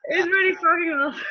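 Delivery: real tearful laugh on "awesome", a touch fast; overwhelmed, laughing and crying at once; laughing through tears. Real, not acted. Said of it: "it teared me up".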